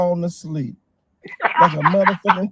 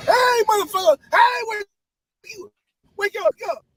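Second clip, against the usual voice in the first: shouting to wake him; 12 semitones higher than usual. Real, not acted. Yelling at sleepy driver.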